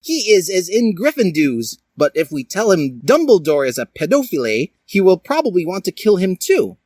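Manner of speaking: high squeaky voice; Link's voice. Acted. Link suggesting Sasuke to the gang, in a high squeaky voice. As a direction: pipe it up like a kid. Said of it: "said Link".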